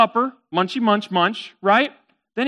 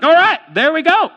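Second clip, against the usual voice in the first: high, loud call; eager announcement; 9 semitones higher, 7 dB louder than usual. Acted. Merchant to his camel as they set off, eager. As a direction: announce it brightly, pitched up. Acting the merchant ready to leave.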